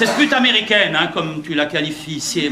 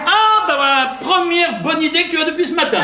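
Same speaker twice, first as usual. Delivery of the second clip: high-pitched, exasperated praise; sarcastic approval; 10 semitones higher than usual. Acted. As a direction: congratulate him with biting exasperation. Raille l'offre d'un verre. (approbation sarcastique, haut perchée)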